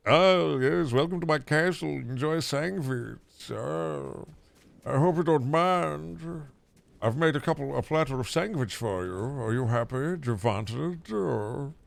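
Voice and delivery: high, slow, hushed vampire host; a count welcoming guests to his castle, mock-sinister. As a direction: purr it like a vampire offering a feast. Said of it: "Sound like a vampire when I say sandwich?".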